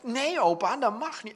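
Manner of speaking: high, loud mock protest; angry grandchild. Grandchild forbids grandpa to take the book, angry. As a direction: imitate a cross little child telling grandpa off. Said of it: "dan wordt ze op een gegeven moment boos".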